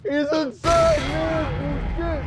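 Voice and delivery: high, loud gleeful yell; excited shout. Real, not acted. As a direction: shout it back. Echoing the shouted joke.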